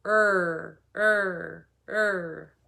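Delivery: "Er" as a held R sound; letter-sound drill. Shown as a demonstration. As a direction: sustain each "Er" on a curled-tongue R, separately. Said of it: "Robbie Rabbit says".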